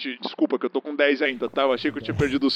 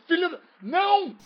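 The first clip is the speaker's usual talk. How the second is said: anguished cry; 14 semitones higher than usual. Acted. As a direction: yell "no" as they escape. Hostage runs off, cries out.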